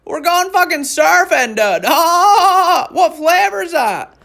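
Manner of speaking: very high, hollered surfer-dude voice; hammed-up mocking surfer yell; surfer bro. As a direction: whoop like an over-stoked surfer. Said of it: "Like a surfer bra".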